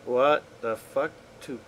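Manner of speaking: ordinary delivery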